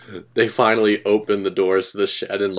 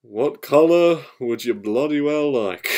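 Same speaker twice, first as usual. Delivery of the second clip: slow, drawn-out, higher-pitched voice; grudging Fat Controller; 5 semitones higher, slower than usual. Acted. Fat Controller to the newcomer, grudging offer. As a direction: concede it slowly, word by word.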